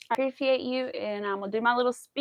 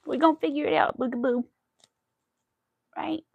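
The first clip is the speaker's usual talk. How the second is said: talking to pet; 5 semitones higher, 4 dB louder than usual. Real, not acted. Comforting her pet.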